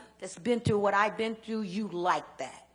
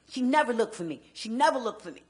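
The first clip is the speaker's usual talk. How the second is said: hurt emphasis; 3 semitones higher, 8 dB louder than usual. Real, not acted. Same words said twice.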